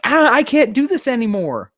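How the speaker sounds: high, hammed-up whining complaint; mock the narrator's despair, pitched high; imitating a whiny protagonist; mock whine. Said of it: "don't have him saying things like".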